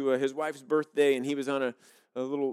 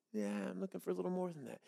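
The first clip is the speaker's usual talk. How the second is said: much softer, calm God voice; God answering; 3 semitones higher, 10 dB quieter than usual. Acted. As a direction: gently turn down the offer as God. God's voice answering.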